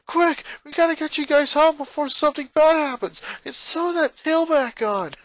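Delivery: high, loud, rushed father; urgent and frantic; panicked dad. Acted. Father hurrying the kids home to fix the suit, panicked. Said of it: "Lynn Senior said in panic".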